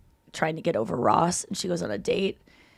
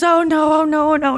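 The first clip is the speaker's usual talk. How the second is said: high, loud wail of embarrassment; mock dismay; 7 semitones higher, 5 dB louder than usual. Woman sliding down her ex once found out, hammed-up dismay. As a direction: imitate a mortified, repeated lament.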